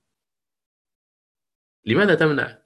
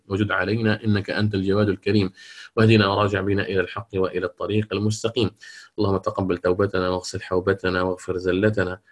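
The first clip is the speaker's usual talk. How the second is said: lower, steady supplication voice; prayer; 4 semitones lower than usual. Acted. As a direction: close the lesson with a calm prayer. Closing supplication. (دعاء ختام الدرس بصوت هادئ)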